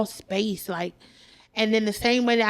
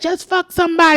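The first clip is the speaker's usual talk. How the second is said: higher, louder mock urging; urging friend; 6 semitones higher, 5 dB louder than usual. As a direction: push it like an impatient friend's advice. Acting out friends urging her.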